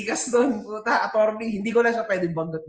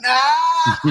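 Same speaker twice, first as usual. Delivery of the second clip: loud shout into a laugh; triumphant yell and laugh; 4 semitones higher, 5 dB louder than usual. Real, not acted. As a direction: let out a victory whoop.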